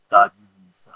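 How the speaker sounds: normal talking voice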